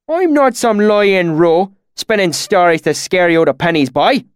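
high, loud, irritated country bumpkin; plainly irritated. A narrator's reading. Swineherd denying he spins stories, irritated and rustic. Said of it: "he said, plainly irritated".

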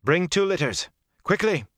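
high-pitched urgent order; urgent call. A narrator's reading. Moraine orders litters from the outer room, calling urgently. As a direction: call out for help at once. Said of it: "Moraine called".

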